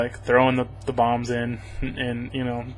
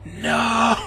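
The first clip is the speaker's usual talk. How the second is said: high, hammed-up wail of no at a dead girl; mock anguish; 5 semitones higher than usual. Reviewer mocking the hero's grief over the girl, hammed-up high wail. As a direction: play the grieving hero over the top, pitched way up. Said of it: "for, like, five minutes, he's like".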